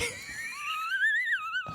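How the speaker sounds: high, cracking shriek of laughter. Real, not acted. Podcaster cracking up at dad's excuse, shrieking laugh. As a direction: burst into a squealing laugh.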